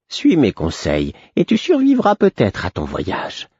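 sly, riddling fool's counsel. A narrator's reading. The Fool's parting advice.

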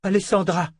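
calling her back by name. A narrator's reading. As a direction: call after someone leaving. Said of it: "appela Anna".

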